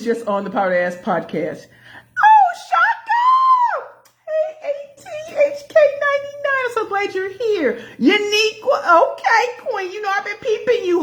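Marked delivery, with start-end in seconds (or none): 2.2-7.8 high, drawn-out joyful call to a viewer; excited shout-out. Real, not acted. Greeting livestream viewers loudly. 8.0-11.0 light up at seeing her; excited greeting. Real, not acted. Greeting a viewer with a laugh.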